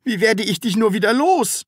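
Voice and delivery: high, loud lament; wailing. A narrator's reading. Taschenbier at a loss with the Sams, wailing. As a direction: whine in despair. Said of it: "jammerte Herr Taschenbier".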